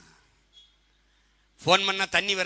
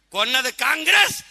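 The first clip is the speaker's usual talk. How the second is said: raised, drawn-out rally shout; loud accusation; 7 semitones higher, 9 dB louder than usual. Real, not acted. Politician accusing a party before a crowd, loud accusation. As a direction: hurl the charge out loud and high, slowly.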